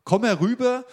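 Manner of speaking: high, loud challenge across a battlefield; Goliath's shouted summons. Acted. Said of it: "rief er David zu".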